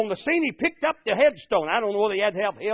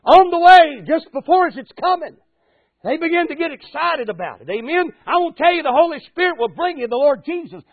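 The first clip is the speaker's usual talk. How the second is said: loud, high, rising preacher's build-up; preaching at full force; 4 semitones higher, 6 dB louder than usual. Acted. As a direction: lift the congregation, voice climbing.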